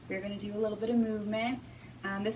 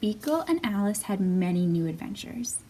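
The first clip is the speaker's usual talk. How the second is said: put-on lively read-aloud for small children; storybook reading; 5 dB louder than usual. A narrator's reading. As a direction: read it like storytime, bright and expressive. Reading picture book to children.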